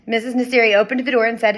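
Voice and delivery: usual speaking voice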